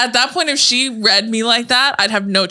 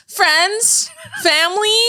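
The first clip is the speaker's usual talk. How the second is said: high-pitched mock address to loved ones; imitating movie character; 13 semitones higher, much slower than usual. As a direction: call out each word high, in imitation. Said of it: "the way she's like".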